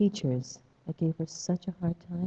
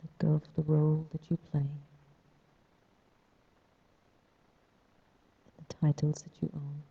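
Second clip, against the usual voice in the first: guided meditation. Acted. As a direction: ease them into stillness.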